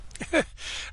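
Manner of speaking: airy high laugh; breathy amused chuckle. Acted. Officer laughing at Zaphod's confusion.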